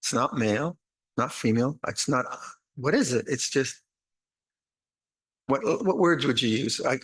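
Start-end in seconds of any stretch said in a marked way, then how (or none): none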